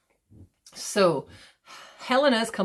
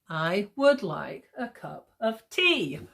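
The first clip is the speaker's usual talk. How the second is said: slow, beat on "would", "cup", rising to "tea"; iambic stress; much slower than usual. Shown as a demonstration. Teacher's modern day English example, slow iambic stress. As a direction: lean on every second word, hitting "tea". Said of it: "try and do it in iambic pentameter".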